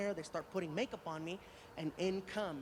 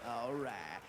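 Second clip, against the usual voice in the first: very low, laid-back drawled impression; Matthew McConaughey; 8 semitones lower than usual. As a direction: drop way down into a cool Southern drawl. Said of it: "I hope his accent's the same".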